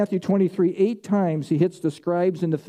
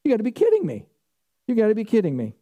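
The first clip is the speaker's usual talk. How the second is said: scoffing, pitch jumping much higher on kidding; mocking disbelief; 5 semitones higher, faster than usual. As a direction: sneer it like an outsider who can't believe it. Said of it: "point at you and say".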